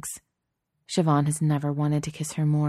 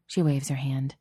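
usual speaking voice

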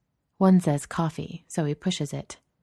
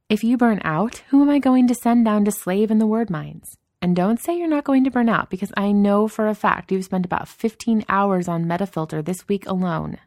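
raised, louder voice of a nagging boss; Danny, exasperated boss; 5 semitones higher, 5 dB louder than usual. Acted. Boss warning an employee about burnout, exasperated teasing. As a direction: lecture him with mock exasperation, pitched up.

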